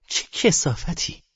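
slow, hissed loathing; seething disgust. A narrator's reading. She answers the narrator, boiling with hatred, seething. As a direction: spit it out slowly, half-voiced, high with revulsion. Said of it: "با هیجانی از نفرت جوشان گفت".

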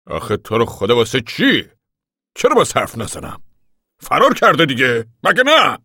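loud, heated objection climbing at the end; indignant protest. Acted. Pa refuses to be hushed about Connie, indignant. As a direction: argue back, voice rising to a high challenge.

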